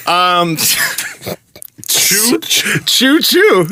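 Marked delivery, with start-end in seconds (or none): 1.9-3.7 playful choo-choo train call, rising; train whistle choo choo. Pretend. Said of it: "It's like a train".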